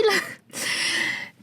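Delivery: stifled, breathy little chuckle; short laugh. Real, not acted. Young woman telling her own pregnancy story, short self-amused laugh. Laughs after saying she is fertile.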